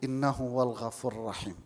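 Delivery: ordinary delivery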